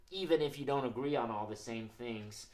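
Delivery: ordinary delivery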